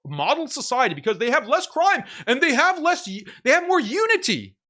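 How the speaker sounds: fired-up taunting of a rival, escalating; heated mocking rant. Real, not acted. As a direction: pile on the taunts, voice climbing. Ranting mockery at opponent.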